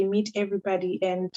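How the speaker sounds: usual speaking voice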